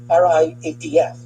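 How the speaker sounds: ordinary talk, nothing marked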